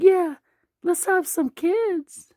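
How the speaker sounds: very high falsetto, drawn-out wife voice; eager wife. Acted. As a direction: agree keenly in a squeaky put-on voice. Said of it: "She's like".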